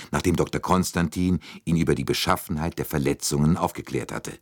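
ordinary delivery, nothing marked

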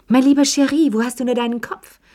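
high, loud opening exclamation; exasperated sighing reproach. A narrator's reading. Madame Pelou reproaches forgetful Cherie, exasperated. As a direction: chide with theatrical exasperation. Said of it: "seufzte Madame Pelou".

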